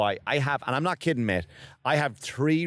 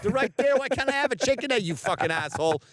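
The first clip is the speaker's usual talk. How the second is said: indignant thief; 6 semitones higher than usual. Retold or imitated. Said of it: "I'm looking at the chicken eggs".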